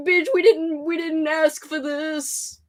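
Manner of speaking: drawn-out whine, slowing at the end; hammed-up viewer complaint, dragged out; mock whiny complaint. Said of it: "they're like".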